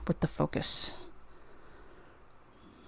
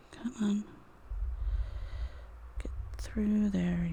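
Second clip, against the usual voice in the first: whispered coaxing; 3 semitones higher, 6 dB quieter than usual. Real, not acted. Coaxing the wire through.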